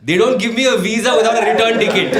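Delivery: high-pitched indignant mock yell; yelling back. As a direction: yell your defence across the street. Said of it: "So then I yelled back".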